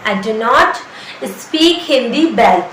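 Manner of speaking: very slow, drawn out; slow teaching demo. Shown as a demonstration. Teacher drilling the sentence very slowly. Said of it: "मैं अच्छी तरीके से".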